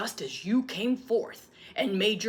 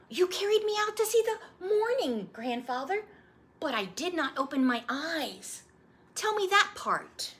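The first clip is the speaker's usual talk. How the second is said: put-on high, eager child voice; a little boy pleading for the story; young boy; 12 semitones higher, 3 dB louder than usual. Boy asking his grandfather to retell his birth, eager child voice.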